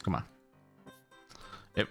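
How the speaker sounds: ordinary delivery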